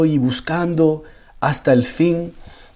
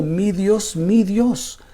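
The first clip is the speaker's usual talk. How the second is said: mild crying plea; 6 semitones higher, much slower than usual. Retold or imitated. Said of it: "Jesús le está llorando a Dios".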